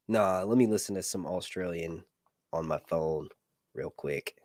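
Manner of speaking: ordinary talk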